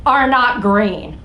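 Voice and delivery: usual speaking voice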